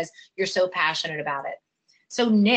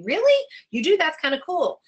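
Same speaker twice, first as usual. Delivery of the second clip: higher-pitched surprised listener, lightly acted; mock impressed; 8 semitones higher, 4 dB louder than usual. Listener reacting to a stranger's job, mock impressed. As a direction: play someone suddenly intrigued, pitched up.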